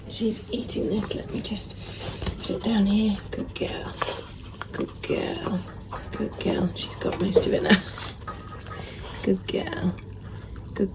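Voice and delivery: soft whisper to the puppy; whispered praise. Real, not acted. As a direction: hush it so she keeps eating. Whispering beside the eating puppy.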